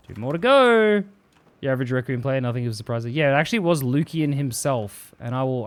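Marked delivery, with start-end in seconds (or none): 0.1-1.0 high, loud progress call; raised, drawn-out cheer. Real, not acted. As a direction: sing out the count, pitched way up. Calls out progress in game.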